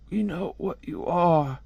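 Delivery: slow, soft, squawky bird. Acted. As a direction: answer slowly as a little talking bird. Acted bird answering Hellboy.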